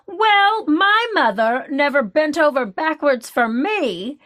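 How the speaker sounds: huffy mom impression with a high Well. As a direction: play the put-upon mother, high and drawn out. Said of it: "so I'm just gonna do her impression".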